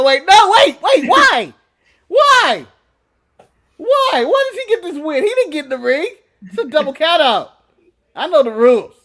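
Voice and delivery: high, loud indignant disbelief at the ref; retold protest. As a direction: shout up high, outraged. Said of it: "And I go".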